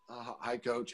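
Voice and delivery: put-on a shy kid barely answering; mumbled hi. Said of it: "and he goes".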